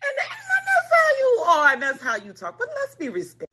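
high-pitched imitation of his scolding; hammed-up, squeaky reprimand broken by laughter; mock reproving man. Man tells her to watch her cussing, mock high-pitched scolding. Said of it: "the man can't stand for me to cuss at him".

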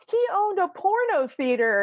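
high, slow, stunned grandma quote; hammed-up high quoting of a little grandma; quoting grandma in shock. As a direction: mimic her saying it, drawn out and high. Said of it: "to hear those words come out of my little bocce's mouth".

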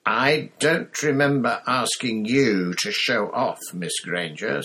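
slow, icy teacher's reproach; cold. A narrator's reading. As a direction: chill every word. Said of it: "said Snape coldly".

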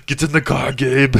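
high, loud mock Terminator accent. Friend playing the robot girlfriend, loud Terminator accent. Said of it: "she has an accent".

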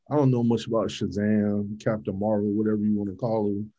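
ordinary delivery

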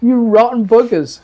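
high-pitched mock outrage; indignant insult. Comic insult at the two guys.

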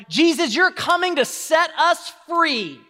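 high, fervent crowd plea; acted crowd cry. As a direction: proclaim it like a hopeful crowd. Crowd on the road hailing Jesus.

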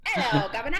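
laughing through a mock British greeting; mock-British hello, breaking into laughter; jokey British accent, half laughing; British accent again, laughing. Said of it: "you wanna hear my British accent?".

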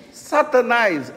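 Satanás drawn out slowly and firmly; slow and clear. Shown as a demonstration. Pastor showing teens how to say Satanás openly. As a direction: face the word Satanás, say it plainly and slowly.

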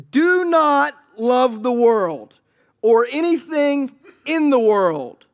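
solemn scripture reading. Acted. Preacher reading First John aloud to the congregation, slow and solemn. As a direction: lay each word down slowly and loudly.

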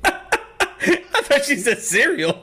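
words squeezed out through giggles; laughing. Real, not acted. To friend about the kid, laughing through it. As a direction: laugh helplessly while explaining. Laughing at misheard kid's word.

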